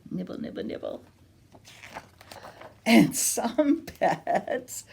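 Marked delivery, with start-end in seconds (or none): 0.1-1.0 whispered little nibbles; hushed playful nibble; soft nibbling. Pretend. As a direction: nibble the words quietly. Acting out nibbling.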